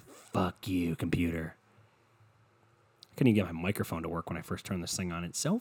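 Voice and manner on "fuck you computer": softer, low whispered "computer"; muttered curse. Retold or imitated. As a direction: curse it under your breath. Cursing the computer under breath.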